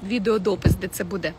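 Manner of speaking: normal talking voice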